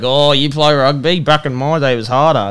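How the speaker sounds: loud mimicry of a boastful bloke; mock an old-days boaster, loud and stretched out; mock pub bloke. Bloke butting in about rugby back in his day, loud mock boast. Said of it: "you got one bloke".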